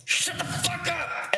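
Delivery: high, slow gruff dad order; mock angry dad. Dad walking in on noisy kids, slow high mock bark. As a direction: bark it out like a fed-up father. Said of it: "he'd just come in and go".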